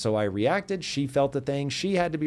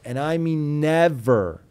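"never" slow and punched loud; stress on never; 3 semitones higher, 5 dB louder, slower than usual. Real, not acted. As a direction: hit "never" hard and drag it out. Insisting on a firm rule.

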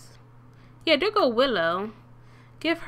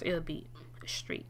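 whispered aside; 4 semitones lower, 11 dB quieter than usual. Real, not acted.

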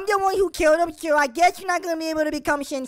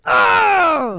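straining attack grunt; 7 dB louder than usual. Acted. Kid firing special attack.

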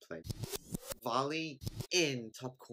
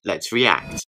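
loud, energetic show opener; hyped intro; 3 semitones higher, 14 dB louder than usual. Real, not acted. Host opening the reaction video to viewers, loud and hyped. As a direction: kick off the video with big energy.

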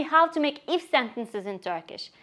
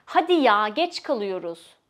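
annoyed; 3 semitones lower than usual. Shown as a demonstration. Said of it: "especially when you're annoyed".

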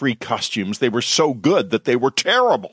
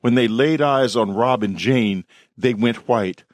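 plain reading voice

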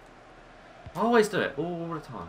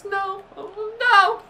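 anguished cry; 4 dB louder, much slower than usual. Real, not acted. Dismay at conceded goal.

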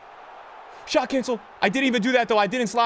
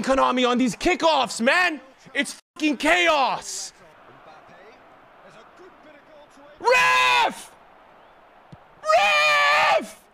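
berserk rage; slower than usual. Real, not acted. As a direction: rage at the game. Raging at kickoff chaos.

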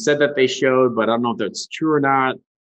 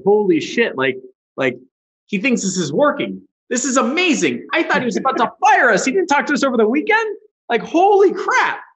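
much higher, building giddy excitement; excited disbelief; 8 semitones higher than usual. Retold or imitated. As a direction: gush like you just dodged getting fired. Retelling relief that client is happy.

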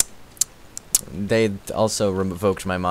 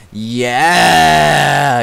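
long, loud, raised yeah; triumphant cheer; 4 semitones higher, 7 dB louder than usual. Real, not acted. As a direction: cheer and stretch it out. Celebrating viewer count.

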